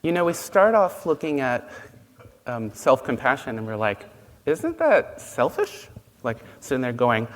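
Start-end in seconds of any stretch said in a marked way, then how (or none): 4.5-5.9 high, drawn-out skeptical question; mock doubtful. Students question self-compassion, mock doubtful. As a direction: imitate a doubter, slow and pitched well up.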